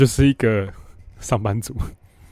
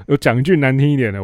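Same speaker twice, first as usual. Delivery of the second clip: ordinary delivery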